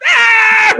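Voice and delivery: loud, high startled shriek, acted out; imitated yell of fright; answering scream. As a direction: scream back in shock. Startled teller screams back.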